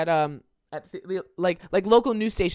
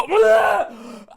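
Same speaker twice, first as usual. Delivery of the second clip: loud, high-pitched disgusted "ugh"; mock retching yell; 13 semitones higher, 8 dB louder than usual. Moviegoer sickened by the scene, loud hammed-up disgust. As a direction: let out an exaggerated gagging cry.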